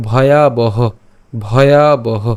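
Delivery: ভয়াবহ as "bhayaa-baho", aspirated first sound; deliberate, falling ভয়াবহ; clear bh and b. Shown as a demonstration. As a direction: stretch ভয়াবহ carefully, plain b in the middle. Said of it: "উচ্চারণটা হবে ব".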